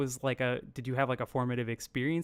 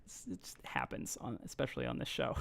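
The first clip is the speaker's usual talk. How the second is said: much softer throwaway aside; quiet aside; 13 semitones lower, 11 dB quieter than usual. Real, not acted. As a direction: drop to a near-whisper aside. Self-deprecating aside about sidetracking.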